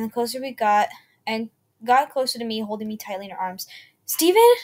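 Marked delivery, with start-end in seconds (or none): 4.1-4.5 high, loud, frightened call of a name; a mother calling, voice shaking and loud; trembling call. A narrator's reading. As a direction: cry out his name in fear. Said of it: "with trembling voice".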